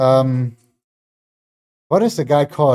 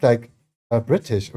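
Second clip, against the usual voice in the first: ordinary talk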